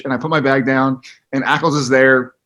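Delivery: normal talking voice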